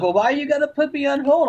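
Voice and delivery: high, indignant whine of a caller; annoyed caller. Retold or imitated. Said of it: "He's like".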